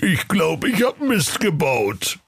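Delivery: slow, high, pitiful whimper; plaintive confession, drawn out and high. A narrator's reading. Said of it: "Der Knecht Ruprecht wimmerte".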